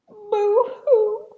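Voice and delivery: high mock crying. A narrator's reading. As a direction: jeer with a fake, high boo-hoo. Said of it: "the bat mocked".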